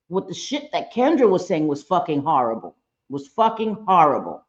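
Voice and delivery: normal talking voice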